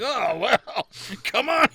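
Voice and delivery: high, laughing coaxing voice; coaxing drunk friend. Retold or imitated. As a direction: egg them on with a laugh. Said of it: "It's like".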